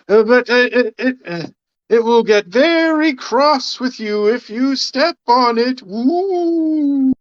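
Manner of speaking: high, flustered ghost warning; hammed-up spooky falsetto, ending in an ooh; library ghost. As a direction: threaten feebly as a hooting phantom. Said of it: "I'm the ghost of the library".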